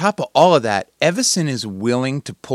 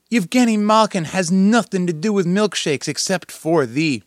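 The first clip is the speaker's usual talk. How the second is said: ordinary talk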